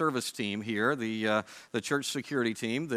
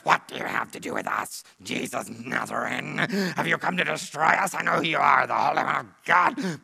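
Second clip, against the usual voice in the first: growling, rough demon cry, building louder; demonic voice; 2 semitones higher, 5 dB louder than usual. Acted. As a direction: roar it out like an unclean spirit challenging Jesus. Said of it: "that's how I picture him speaking".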